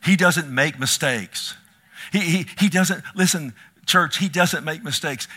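loud, emphatic preaching; forceful preaching. Real, not acted. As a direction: hammer home that God makes no mistakes.

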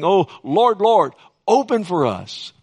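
slow, high, loud calling out; imitated pleading cry. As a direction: cry out to be let in, drawn out. Shut-out people beg at door.

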